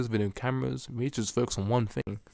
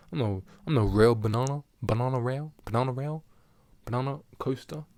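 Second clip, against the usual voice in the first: ordinary delivery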